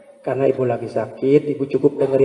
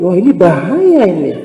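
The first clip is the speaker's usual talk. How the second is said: louder mock alarm at a patient; tactless alarmed visitor; 4 semitones higher, 8 dB louder than usual. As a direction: imitate a careless visitor scaring the sick. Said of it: "Jangan kata-kata seperti tadi".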